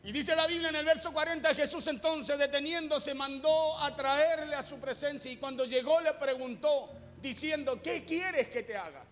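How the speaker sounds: impassioned preaching. Acted. Preacher retelling blind Bartimeo's plea to his congregation, impassioned preaching.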